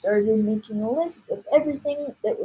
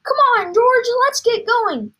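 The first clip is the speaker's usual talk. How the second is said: high, loud call to action; rally the boy to get moving; eager father calling out; urging excitedly; 12 semitones higher, 7 dB louder than usual. Acted. Excited father hurrying his son.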